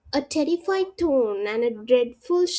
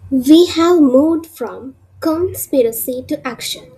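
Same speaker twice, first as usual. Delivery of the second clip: loud, emphatic hook like a trailer voiceover; dramatic narration; 9 dB louder than usual. Acted. Teacher opens the episode with a loud, dramatic hook. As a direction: drive each phrase home to grab the class.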